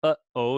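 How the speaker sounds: "uh-oh" broken by a throat catch; glottal stop. Shown as a demonstration. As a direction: stop the breath between "uh" and "oh". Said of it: "this little symbol is representing".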